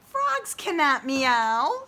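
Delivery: a lightly voiced cat setting Freddy straight. A narrator's reading. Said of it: "said the cat".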